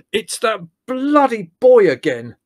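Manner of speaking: loud, high outraged bellow, words drawn out. Acted. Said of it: "bellowed Larry".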